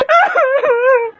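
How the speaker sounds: very high, loud wordless vocal burst; mock outburst. Winner's friend taunts the loser, high mocking cackle. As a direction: let out a high-pitched staccato cackle.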